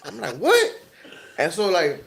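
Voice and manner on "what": very high, rising squeak of disbelief; incredulous. Retold or imitated. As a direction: act out a startled double take, voice leaping up. Retold startled reaction to insult.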